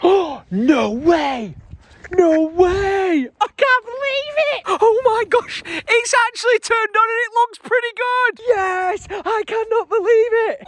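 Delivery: real elated surprise at the lit tree; thrilled disbelief. Real, not acted. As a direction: burst out in delighted disbelief. Tree lights turn on.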